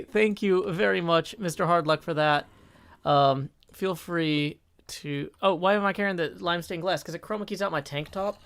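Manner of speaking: ordinary talk, nothing marked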